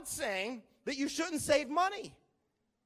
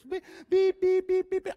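high, loud, quickening beeps; imitated detector beeps; 8 semitones higher, 6 dB louder than usual. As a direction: mimic a metal detector finding something. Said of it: "It would be like, beep".

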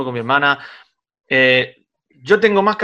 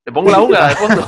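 laughing; 3 semitones higher, 5 dB louder than usual. Real, not acted. Joking about background music.

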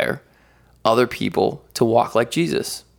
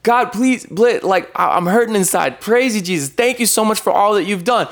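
prayer; 9 semitones higher than usual. Retold or imitated. Praying aloud to God.

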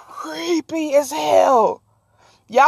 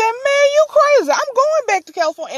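incredulous; 12 semitones higher, 3 dB louder than usual. Retold or imitated. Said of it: "He said".

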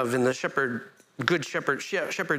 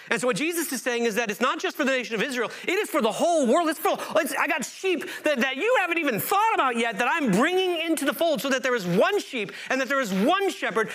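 raised, driving sermon voice; impassioned preaching; 9 semitones higher than usual. Acted. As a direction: preach it out wide and strong. Preaching at full force, raised.

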